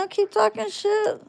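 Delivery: high, sing-song dare to keep going; mocking taunt. Real, not acted. Streamer goading a rude chatter, mocking high taunt.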